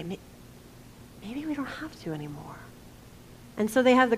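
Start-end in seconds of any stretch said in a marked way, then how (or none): 0.0-2.6 dropping to a near whisper; hushed hope. Retold or imitated. Disciples' hopeful inner voice.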